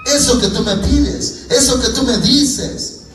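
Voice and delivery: high, pleading protest; acted anguish. Frightened believer pleading with the Lord that he cannot, in acted anguish. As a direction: plead helplessly, pitched high and peaking on the last refusal. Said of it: "Su carne se angustiaría".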